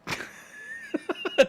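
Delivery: breathless squeaky giggle; high wheezing laugh. Real, not acted. Gamer wheezes laughing at a friend's bit.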